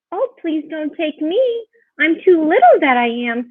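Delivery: high, pleading small-goat voice; little billy goat. A narrator's reading. Little goat begging the troll, pleading in a high voice. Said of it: "said the billy goat".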